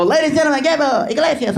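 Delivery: high-pitched show host introduction; Jay Leno. Acted. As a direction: announce the next act in a put-on host voice. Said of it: "you hear Jay Leno go".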